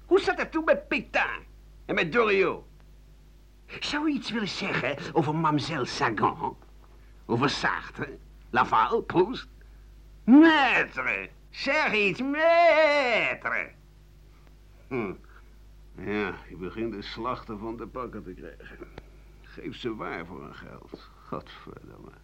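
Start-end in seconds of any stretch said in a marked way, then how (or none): 0.1-9.5 high, needling interviewer imitation; a mock reporter fishing for scandal; pushy reporter voice. Journalists' questions voiced, pitch leaps. 10.2-13.7 higher and louder, calling out Maître; insistent calls of Maître that climb in pitch; mock clamoring reporter. 21.3-22.1 muttered oath under the breath; breathless unvoiced swear; whispered curse.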